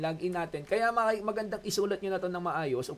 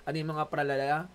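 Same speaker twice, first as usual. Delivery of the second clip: normal talking voice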